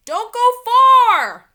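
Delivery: loud, slow call from the house. A narrator's reading. Dad calling after the kids from inside, loud and drawn out. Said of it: "their dad called".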